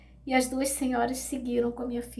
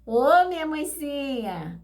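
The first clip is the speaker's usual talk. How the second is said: slow, louder drawn-out call; girl calling her mother, slow and louder; calling daughter; 4 semitones higher, 6 dB louder, slower than usual. Retold or imitated. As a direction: call out to mother from behind, slowly. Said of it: "Aí ela".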